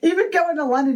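normal talking voice

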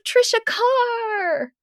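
very high, long drawn-out squeal of a name; mock gushing. Friend greeting her by full name, high mock gushing squeal. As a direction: imitate a starstruck acquaintance, stretching the name sky-high.

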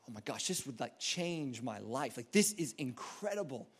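low, hushed awe; stunned, quiet disbelief; hushed amazement. Acted. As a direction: marvel under your breath, slowly taking it in. Acts out awed treasure discovery.

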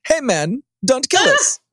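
high, quick mock German soldier; hammed-up falsetto German; bad German accent. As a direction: plead in a squeaky, badly faked German accent. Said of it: "I can't do a German accent".

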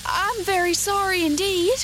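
high, loud, alarmed apology; frightened girl. A narrator's reading. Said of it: "who was truly frightened".

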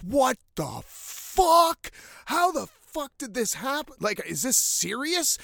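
high, slow, drawn-out what the fuck; stunned disbelief. Retold or imitated. Friends watching a turtle grab the fish, in shocked disbelief. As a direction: gape at what you just saw.

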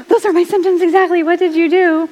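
breathless eager question; eager follower. Retold or imitated. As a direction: gush like a viewer who found her answer. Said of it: "direct message me and say".

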